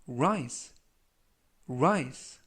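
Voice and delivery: "rise" with a clear diphthong ai and a buzzing final s; model pronunciation. Shown as a demonstration. Teacher modelling the word for Korean learners, careful pronunciation. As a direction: say "rise" distinctly, ending on a voiced z. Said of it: "라고 발음하시면 되겠고요".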